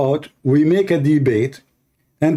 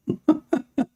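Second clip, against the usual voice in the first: high, softer chuckle; mocking laugh; 9 semitones higher, 5 dB quieter than usual. Real, not acted. Laughing at what the opponent called accurate, mocking laugh. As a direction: chuckle in a high, quick burst.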